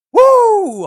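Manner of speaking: high, loud whooping cheer. Acted. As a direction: whoop it out like a hype man. Streamer cheering on wholesome stream.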